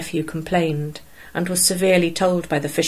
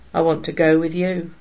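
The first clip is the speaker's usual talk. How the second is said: sullen; 3 dB louder than usual. A narrator's reading. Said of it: "he said in a sullen tone".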